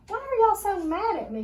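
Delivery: high, loud hurt plea; wounded child pitched high. A narrator's reading. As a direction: ask why everyone is angry, hurt. Child hurt, classmates leave.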